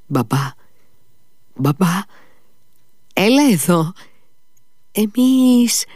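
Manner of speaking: put-on high, frightened child's stammer, rising as it goes; a scared boy calling for his father, voice climbing; sick boy calling his father in fright, high-pitched. A narrator's reading. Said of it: "ψέλισε ... εξαιρετικά τρομαγμένος".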